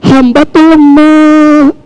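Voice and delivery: humble sincere plea; earnest humble prayer. Shown as a demonstration. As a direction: plead to God as the weak servant. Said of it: "Ibu tuh kudu minta bener".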